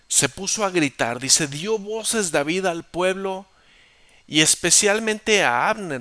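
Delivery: high, loud preaching of the verse; fiery scripture reading. Acted. Preacher reading the verse aloud to the church, fiery and loud. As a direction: proclaim the verse to the church at full voice.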